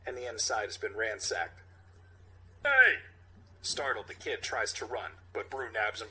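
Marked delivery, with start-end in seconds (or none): 2.6-3.0 loud sharp shout. Acted. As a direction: yell it at the thief. Character shouts at thief.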